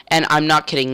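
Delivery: ordinary talk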